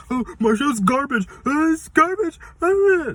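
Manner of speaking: high-pitched mock fan screech; screaming and hollering. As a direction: scream like outraged fans, hammed-up and very loud. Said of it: "there's gonna be screaming and hollering".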